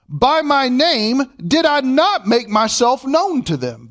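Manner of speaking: slow, emphatic question with NOT stressed; read as a question. Shown as a demonstration. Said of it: "we would read it like this".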